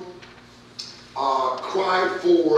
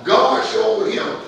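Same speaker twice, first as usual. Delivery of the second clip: ordinary talk, nothing marked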